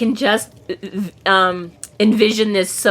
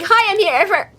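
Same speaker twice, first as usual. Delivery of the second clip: much higher, chirpy mock delivery; mock narrator's misreading; 13 semitones higher than usual. Mocking how Marsters read the line, high exaggerated voice. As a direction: exaggerate a high-pitched greeting. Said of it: "he's like".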